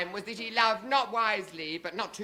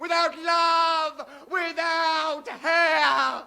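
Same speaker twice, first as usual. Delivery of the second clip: drawn out, dramatic and slow; slow oratory; 7 semitones higher, 3 dB louder, much slower than usual. Acted. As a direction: stretch each word for effect. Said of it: "mock oratory climax".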